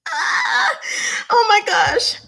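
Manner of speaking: high, loud alarmed "oh my gosh"; a high, loud cry of panic; shocked gasp. Retold or imitated. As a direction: recoil in sudden alarm. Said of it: "And I was like".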